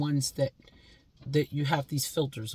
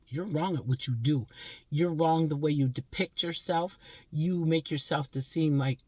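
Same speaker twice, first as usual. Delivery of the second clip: ordinary delivery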